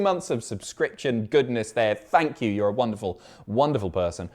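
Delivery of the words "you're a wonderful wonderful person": normal talking voice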